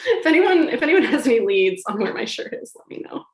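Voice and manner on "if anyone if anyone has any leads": loud appeal. Real, not acted. Appeal to listeners.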